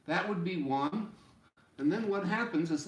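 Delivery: ordinary delivery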